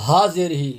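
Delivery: usual speaking voice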